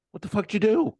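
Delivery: high-pitched incredulous demand; exasperated outburst, retold. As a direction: re-enact scolding a lost driver. Retold outburst at lost driver.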